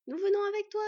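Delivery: put-on high, loud girlish call; called-out eagerness. A narrator's reading. Twins dashing ahead down the stairs, calling eagerly. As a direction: call it out as you rush off. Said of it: "lui lancèrent-elles".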